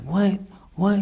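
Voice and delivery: high, loud retold "what"; mother's shocked reaction. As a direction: imitate a startled mom on the phone. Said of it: "my mom, she's like".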